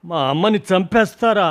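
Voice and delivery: high, loud furious yell; a boy yelling at the staff to stop hurting his mother; angry shout. A narrator's reading. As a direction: scream it at the staff in rage. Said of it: "అంటూ ... విసిరి కొట్టాను".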